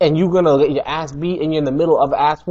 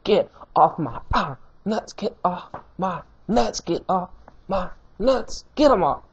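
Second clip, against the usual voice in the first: mock taunt; 4 semitones higher, 4 dB quieter than usual. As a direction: sing it out, brushing him off. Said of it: "came in there and said".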